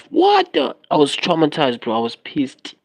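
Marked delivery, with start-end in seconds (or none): 0.1-0.8 high, startled squeak of disbelief; shocked. Retold or imitated. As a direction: jump up high in sudden shock. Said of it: "And I was like".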